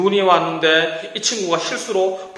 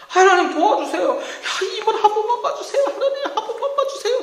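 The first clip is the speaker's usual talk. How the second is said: very high, laughing whiny plea; desperate pleading Jacob. Acted. Jacob begging God, very high and whiny. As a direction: beg shamelessly like a cornered coward. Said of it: "체면불구하고 하나님께 기도하잖아".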